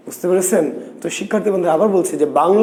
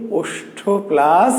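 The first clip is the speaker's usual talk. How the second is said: ওষ্ঠ plus stretched very slowly, rising on plus; drawn-out prompt; much slower than usual. Shown as a demonstration. Teacher coaxing the class to finish, slow rising prompt. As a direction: hold plus and wait for the class. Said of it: "teacher prompts the answer".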